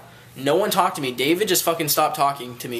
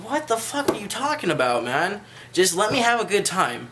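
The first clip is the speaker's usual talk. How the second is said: exasperated retort; 5 semitones higher than usual. Retold or imitated. Retorts to friend's nagging dad.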